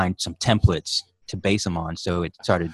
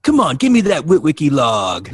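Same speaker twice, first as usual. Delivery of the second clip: high, loud cartoon robot; 7 semitones higher, 6 dB louder than usual. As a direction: demand it like a goofy Autobot. Robot demanding Witwicky log.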